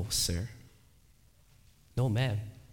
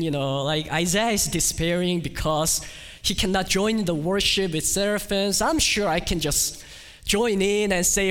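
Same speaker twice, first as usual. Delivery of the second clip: lightly voiced, louder careless skeptic; mock unbeliever; 7 semitones higher, 5 dB louder than usual. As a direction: mimic a cocky unbeliever. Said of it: "may even tell me".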